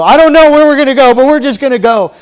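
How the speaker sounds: loud, high acted exclamation; mock eager outburst. Preacher voicing people who'll just go anywhere, loud and eager. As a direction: burst out as the gung-ho follower.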